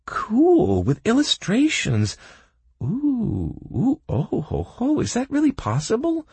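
high squealing delight; excited girl. A narrator's reading. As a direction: gush over the book. Kaelin thrilled by the book.

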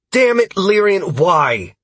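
loud, high exasperated shout. A narrator's reading. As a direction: shout in the middle of a fight. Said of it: "Sierra joined in shouting".

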